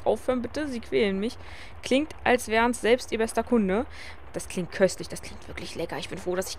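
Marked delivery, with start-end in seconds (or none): 4.3-6.6 hushed, breathless whisper; whispered aside. Acted. Wilma whispers longingly about the dumplings. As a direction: murmur it under your breath, craving. Aside while food is described.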